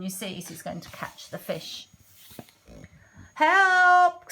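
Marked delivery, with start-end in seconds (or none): 3.3-4.3 high, loud, drawn-out yelp; acted frightened cook calling out; scared cry for help.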